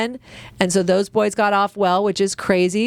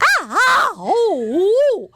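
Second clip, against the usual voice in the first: pained groans; 10 semitones higher, much slower than usual. Retold or imitated. Sinking sore into the jacuzzi, high pained moans. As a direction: groan as the sore body sinks in.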